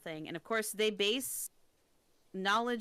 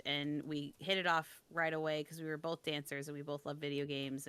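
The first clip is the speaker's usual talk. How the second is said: normal talking voice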